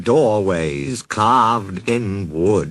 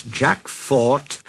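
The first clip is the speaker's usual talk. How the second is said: usual speaking voice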